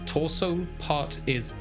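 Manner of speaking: ordinary delivery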